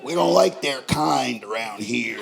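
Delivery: lightly voiced bigoted local; slow bigoted local. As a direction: play the small-town bigot, slowly.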